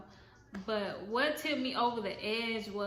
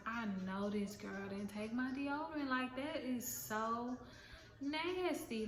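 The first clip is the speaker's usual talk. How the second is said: disgusted inner thought; 8 dB quieter than usual. Retold or imitated. Inner thought about the missing deodorant.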